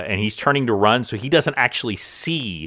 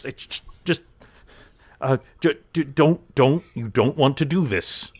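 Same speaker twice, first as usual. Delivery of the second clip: halting, stammered warning, pitched up; cowering cultist; 3 semitones higher than usual. Acted. Intimidated cultist warning his captors, stammering then pompous. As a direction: start small, then warn them with self-importance.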